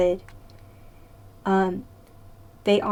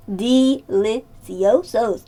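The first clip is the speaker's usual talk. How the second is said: mock Spanish; much slower than usual. Praising canned peaches.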